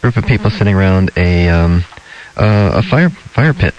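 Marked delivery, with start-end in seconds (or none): none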